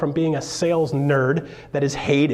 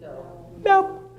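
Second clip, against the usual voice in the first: curt brush-off. Retold or imitated. Customer brushing off clerk.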